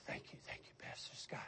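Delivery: breathy timid whisper; imitated whisper. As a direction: whisper it shyly like a nervous boy. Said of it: "That's how he spoke".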